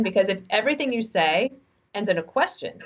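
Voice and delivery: usual speaking voice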